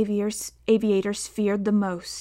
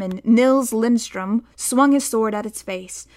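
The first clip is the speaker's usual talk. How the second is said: ordinary delivery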